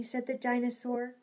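ordinary delivery, nothing marked

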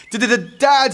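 high, loud panicked stutter. A narrator's reading. Eric caught out by his dad, stuttering. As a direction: stammer on the first sound. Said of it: "stuttered Eric".

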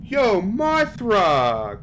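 high, loud, stretched-out protest; drawn-out startled complaint; exasperated yell. Real, not acted. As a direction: holler it slowly at the monster. Protesting at a game monster.

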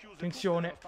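usual speaking voice

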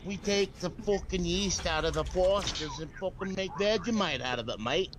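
lightly done Australian accent, mock brewer; bad Australian accent. Mocking how Vegemite gets made, in a bad Australian accent. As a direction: slip into a half-hearted Australian accent. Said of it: "Please don't sound Australian".

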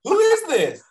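very high, loud mock-baffled squawk; baffled demand. Retelling puzzling phone call from boss, high baffled demand. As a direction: squawk the question at an unknown caller.

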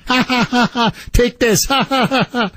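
high, gloating fake laughter; sing-song taunting laugh over the words; mocking laugh. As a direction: cackle at him like a gloating in-law. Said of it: "And they're laughing".

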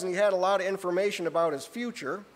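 usual speaking voice

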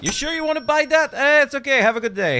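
very high, loud voice right out of a laugh; incredulous cackling squeal. Acted. Cashier doubting a customer's expensive purchase, high giddy squeal.

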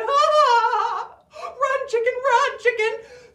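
high, loud cackling escape; gleeful laughing. Acted. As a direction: laugh in triumph while running. Jack laughs while fleeing.

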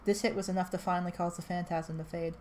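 ordinary delivery

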